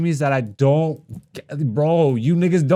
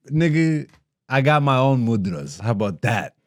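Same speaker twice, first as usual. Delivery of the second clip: normal talking voice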